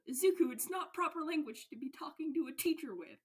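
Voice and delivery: high, loud prim scolding; stern reprimand. Acted. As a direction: scold him like an appalled class president. Ida reprimands Izuku.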